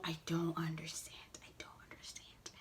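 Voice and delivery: breathy unvoiced whisper of disbelief. Real, not acted. Vlogger whispering her confusion at the show's premise.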